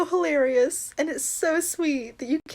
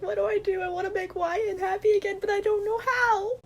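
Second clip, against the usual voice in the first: helpless fretting; 7 semitones higher than usual. Acted. Character at a loss how to cheer a friend, helpless fretting. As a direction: act out a helpless little whine. Acted quote of clueless character.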